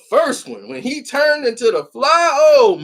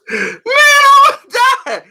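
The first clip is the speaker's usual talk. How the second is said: high, loud, laughing through it; real laughter breaking in; laughing hard; 5 dB louder than usual. Real, not acted. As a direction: crack up while telling it.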